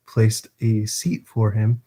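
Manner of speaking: ordinary delivery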